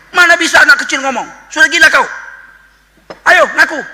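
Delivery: high, loud, furious interrogation; enraged Bani Israel shouting at Mary; angry accusing crowd. Acted. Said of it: "marahnya Bani Israel".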